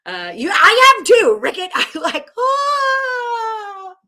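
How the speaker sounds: loud high laughing cry; laughing outburst. Real, not acted. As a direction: burst out laughing. Laughing outburst at viewer comment.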